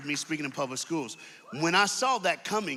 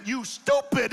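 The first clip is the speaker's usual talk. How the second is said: ordinary talk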